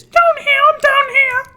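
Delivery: put-on very high, loud falsetto kid voice; teasing the LAB kids in a shrill squeak; mock squeaky kids. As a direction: squeal it like tiny cartoon kids. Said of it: "We got these L A B kids".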